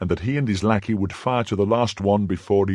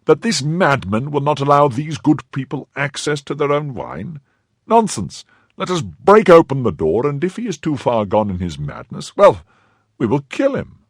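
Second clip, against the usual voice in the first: raised, indignant voice with high outbursts; exasperated protest; 7 semitones higher, 4 dB louder than usual. A narrator's reading. Exasperated gentleman urging his companion to break in, indignant. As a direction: protest hotly, out of all patience. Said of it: "The two gentlemen were exasperated".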